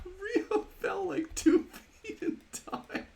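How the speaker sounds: high-pitched, talking through real laughter; can barely get the words out for laughing; giggling in disbelief at a silly death; laughing hard. Real, not acted. Friend died falling two feet.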